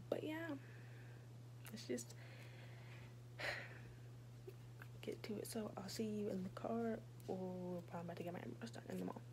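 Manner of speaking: hushed whisper, barely voiced. Real, not acted. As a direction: keep it to a soft whisper.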